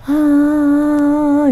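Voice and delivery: a faint, quiet sobbing breath; soft crying gasp. Retold or imitated. Ghost heard through the window, faint sob. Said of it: "Itu masih pelan".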